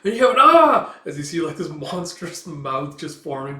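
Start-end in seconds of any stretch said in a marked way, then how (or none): 0.4-1.0 high, loud "ah" cry; sudden high-pitched yell; acted scream. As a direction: scream out in mock terror. Scream as monstrous mouth appears.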